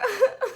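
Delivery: high squealing giggle; a burst of starstruck laughter; giddy fan giggle. Real, not acted. Fan on FaceTime laughs as idol says hi, high giddy giggle.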